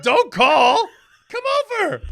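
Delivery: high, loud mock outrage; exasperated shouting. Grown son berates neighbor who only phoned, shouting exasperated. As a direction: shout each command in comic disbelief. Yelled rebuke to absent neighbor.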